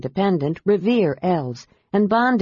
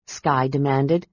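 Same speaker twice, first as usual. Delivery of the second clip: plain reading voice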